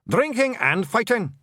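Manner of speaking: high proud clan yell; shout. Acted. The blue men shouting their boast to their chief. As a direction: bellow it out as a boast.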